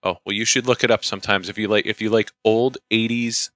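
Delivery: usual speaking voice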